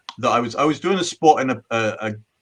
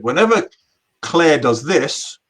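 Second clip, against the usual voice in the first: drawn-out stage build-up; 4 semitones higher, 3 dB louder, slower than usual. Acted. Comic's stage bit, building up.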